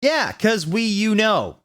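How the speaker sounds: hammed-up sulk; mock angry. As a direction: fire it back irritated. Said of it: "I yielded in an angry voice".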